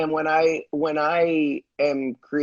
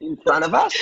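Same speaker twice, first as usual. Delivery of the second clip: put-on Australian accent; 12 semitones higher than usual. Filmmaker pressing a singer to perform, high Australian accent. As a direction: imitate an Australian accent, pitched up in a question. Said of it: "[name] with her Australian accent".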